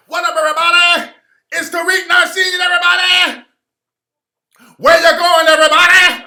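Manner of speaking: high, very loud shouted hype-man voice; screaming crispy character voice; crispy voice yell. Retold or imitated. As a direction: bellow it like a hyped-up character who won't quit. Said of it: "yelling in the crispy voice".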